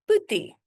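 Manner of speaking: "Boetie" high and loud, first syllable short; demonstrated pronunciation. Shown as a demonstration. Teacher repeats "Boetie" for learners, high and clear. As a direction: say "Boetie" as a high, brief model. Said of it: "Let me show you how to pronounce it".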